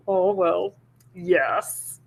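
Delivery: slow, a hint of a lazy dragon brushing her off; lightly voiced bored dragon. Dragon shooing the princess from his door, slow and lightly voiced. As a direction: drawl it slowly like a busy dragon.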